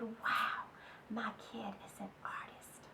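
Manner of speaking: awed whisper. Acted. Mother peeking in on her daughter, whispering in awe.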